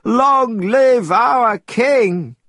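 high, loud, slow cheer; joyful cry. A narrator's reading. Monkeys cheering their returning king, loud and high. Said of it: "cries of".

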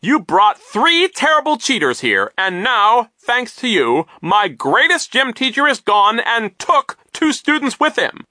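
high, loud, swooping rant; outraged high-pitched character voice; indignant vice principal. A narrator's reading. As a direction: scold and expel at full voice. Nero rages and expels children.

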